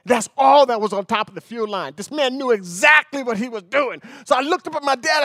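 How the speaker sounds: ordinary talk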